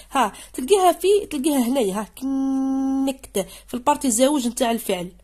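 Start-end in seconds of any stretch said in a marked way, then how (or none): none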